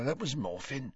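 soft, offhand reply; uncaring, dropped-volume mumble; indifferent. A narrator's reading. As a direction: toss it away as if bored. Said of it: "said the old man indifferently".

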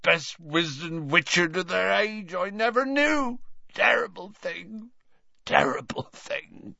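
put-on high-pitched tipsy old Slughorn; 醉醺醺的老教授. A narrator's reading. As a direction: lament it in a high old man's voice.

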